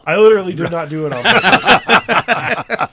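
loud, laughing denial; laughing protest. Real, not acted.

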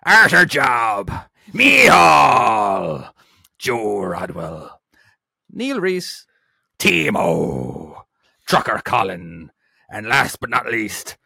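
slow pirate roll call with stretched names, some bellowed. Pretend. Host thanking patrons down to the first, in a pirate voice. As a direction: draw each name out like a hammed-up pirate. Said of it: "in a pirate voice".

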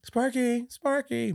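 high, drawn-out pet call; calling a dog. Retold or imitated. Clint outside looking for Sparky, high mock calling. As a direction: imitate a child calling for a lost dog.

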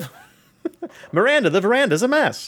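put-on high, laughing old Hollywood voice; glamorous film star, giggling through it; transatlantic accent. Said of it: "a transatlantic accent".

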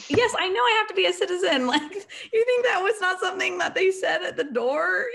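high, loud mock outrage; sarcastic exasperation. Cadet to people breaking the citizenship news, sarcastic exasperation. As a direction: squeal the retort, incredulous and amused.

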